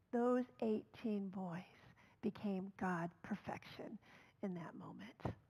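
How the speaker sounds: quoting with reverent softness; hushed quote. Retold or imitated. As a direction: let the words fall to a hush. Moved father's quote.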